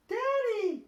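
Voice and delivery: very high, loud piglet call; Peppa crying out. A narrator's reading. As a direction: call Daddy in a high shout. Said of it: "cries Peppa".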